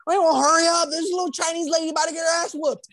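high, urgent mock panic; imitated frantic urging. Caller to 911 about lady under attack, mock frantic. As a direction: act out urging someone to rush.

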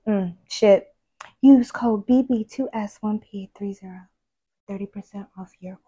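soft sultry whisper read; sexy voice. Pretend. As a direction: seduce the listener with the code. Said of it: "It's like".

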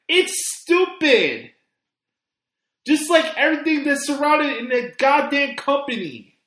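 angry rant. Real, not acted. Furious rant about the card.

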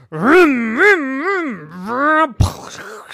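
roaring engine rev, very loud and high; loud engine vroom. Acted. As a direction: rev like a monster car. Wolf car revs to attack.

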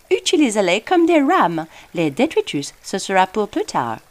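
put-on French spoken with a posh English accent; a helpful British fish giving directions. A narrator's reading. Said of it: "toujours dans un accent très anglais".

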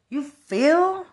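훨씬 높고 크게 외치는 비아냥; 높은 소리로 크게 쏘아붙여; shouted taunt. Real, not acted. Repeated taunting question.

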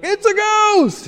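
high, shrieking mock panic; mock terrified cry. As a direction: play the scared disciples shrieking. Disciples voiced in retold story.

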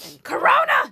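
high, very loud playful accusation; mock alarm shout. Co-host teasing after a sneeze, mock alarm shout.

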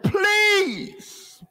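one high, stretched, wailing PLEASE; pleading. Retold or imitated. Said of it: "when somebody said".

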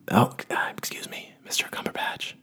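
breathy timid whisper to a famous stranger; barely voiced, don't disturb him. Parents approaching the famous actor at dinner, whispering.

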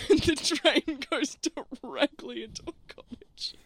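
giggling while telling; words bubbling with laughter; laughing through words. Real, not acted. Narrator laughing while describing the train.

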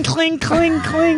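high mock clinks of rings on a snap; ring clinking noise. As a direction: chime the word cling up high like metal rings. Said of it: "but it's like cling".

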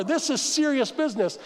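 ordinary delivery

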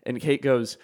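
ordinary talk

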